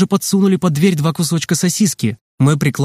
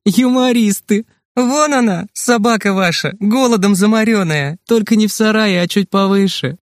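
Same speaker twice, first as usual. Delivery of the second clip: amused, laughing; 6 semitones higher than usual. A narrator's reading. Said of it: "смеясь".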